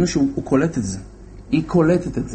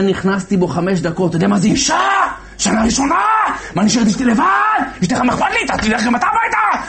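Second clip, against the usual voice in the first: shouting rebuke; 9 semitones higher, faster than usual. Retold or imitated. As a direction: tear into him like an angry commander. Soldier rebukes commander, shouting.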